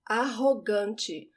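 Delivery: Arrogante with the double R as a breathy h sound; Arrogante, ahogante, clear and slow; Portuguese pronunciation. Shown as a demonstration. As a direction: say Arrogante slowly, rr like English h. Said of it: "The double R in Portuguese sounds like the age in English".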